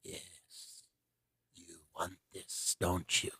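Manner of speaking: low, hushed Emperor Palpatine hiss; tempting devilish whisper. Retold or imitated. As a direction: whisper it like a tempting Sith lord. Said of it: "Palpatine is sitting there and he goes".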